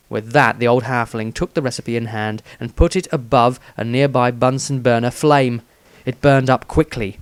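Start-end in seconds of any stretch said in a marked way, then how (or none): none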